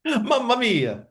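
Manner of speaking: put-on high, slow, hammy Italian accent; exaggerated bad Italian accent, stretched out. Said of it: "one hundred percent bad Italian accents".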